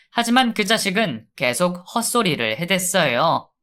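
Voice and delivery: ordinary delivery, nothing marked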